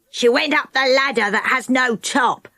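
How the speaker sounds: lightly voiced old woman; a hint of a sour old woman; cross old woman. A narrator's reading. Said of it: "said the old woman".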